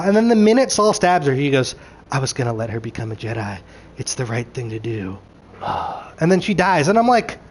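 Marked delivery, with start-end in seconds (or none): 2.1-5.7 low, soft, quick imitation; hushed retold confession. Saul to the woman he stabbed, hushed and low. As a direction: drop right down, much quieter and lower. Retelling Saul's regretful line after stabbing.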